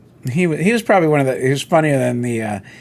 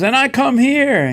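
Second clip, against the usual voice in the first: high, slow mock punchline voice; hint of a comedian's incredulous punchline; 11 semitones higher than usual. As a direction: deliver it like the comedian on stage. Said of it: "He goes".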